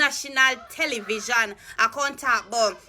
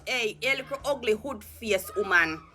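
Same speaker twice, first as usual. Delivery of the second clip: ordinary talk, nothing marked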